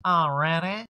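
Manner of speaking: drawn-out put-on query; Erica. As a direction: stretch it out in a character voice. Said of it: "and she goes".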